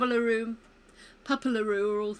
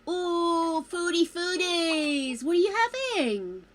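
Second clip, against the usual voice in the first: excited greeting; 7 semitones higher, 8 dB louder than usual. Acted. Greeting the sims at dinner.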